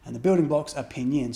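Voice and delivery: normal talking voice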